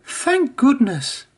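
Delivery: high, slow relieved sigh of words; relieved Emily. A narrator's reading. Emily escapes Whiff, high and relieved. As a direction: let the relief out slowly, high. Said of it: "Emily wished".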